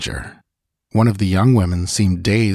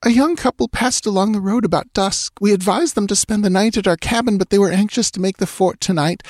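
put-on high, worried young woman's voice; 13 semitones higher than usual. A narrator's reading. Young settler woman telling Balthus about the couple, high. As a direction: tell it anxiously.

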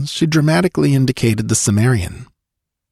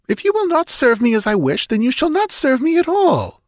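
high-pitched ultimatum; imperious princess. A narrator's reading. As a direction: lay down the law as a royal mistress. Princess gives count an ultimatum.

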